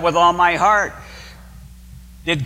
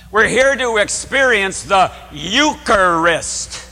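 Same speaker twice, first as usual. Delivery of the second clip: slow emphatic; 5 semitones higher, 4 dB louder, slower than usual. Real, not acted. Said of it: "preaching emphasis".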